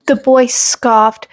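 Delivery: ordinary delivery, nothing marked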